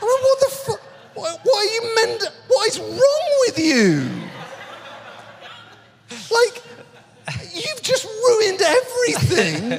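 incredulous at someone so dense; laughing exasperation. Real, not acted. Exasperated at the critic.

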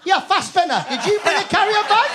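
high, loud mock interrogation; calling out. Winner heckling a fellow nominee about a carrier bag, loud call. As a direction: shout it across the room, laughing. Acted calling out across the room.

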